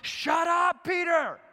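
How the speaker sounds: God from the cloud yelling at Peter; resounding yell. Acted. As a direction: bellow it down from the clouds. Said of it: "he yells out and he resounds".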